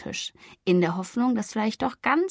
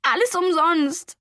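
slow, high, tearful lament; sobbing despair; 8 semitones higher, 4 dB louder, slower than usual. Acted. Flori sinking onto a chair after the rejection, slow and tearful. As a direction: let it break into a sob. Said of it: "Schluchzend sank sie auf einen Stuhl".

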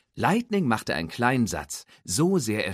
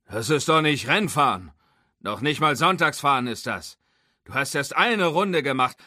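put-on high, loud, gruff old race car; old mentor Doc lecturing on real racing, gruff and loud; 5 semitones higher, 4 dB louder than usual. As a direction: lecture the rookie with crusty authority. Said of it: "seine ruppige Stimme".

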